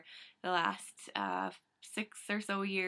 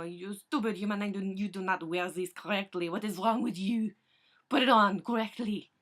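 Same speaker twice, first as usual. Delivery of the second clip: French cat voice; 6 dB louder than usual. Retold or imitated.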